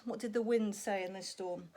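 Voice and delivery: usual speaking voice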